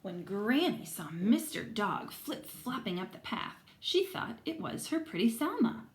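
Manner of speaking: lively read-aloud to a class; story reading to children. Acted. Teacher reading aloud to her class, lively storytelling. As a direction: tell the story to the kids, voicing everyone.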